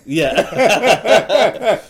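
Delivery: laughing. Real, not acted. Laughing agreement.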